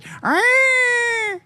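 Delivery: very high animal cry; long, high mimicked animal call; imitated animal screech. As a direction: screech like a peacock far out in the woods. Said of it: "way out in the woods, I hear this".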